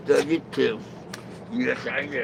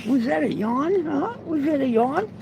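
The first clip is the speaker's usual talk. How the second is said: high falsetto coo, rising questions; teasing baby talk; 11 semitones higher than usual. Pretend. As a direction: tease the dog way up high. Repeats it in falsetto to dog.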